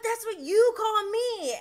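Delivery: high, loud disbelieving question; incredulous. Retold or imitated. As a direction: throw it back in shock. James incredulous at Tom.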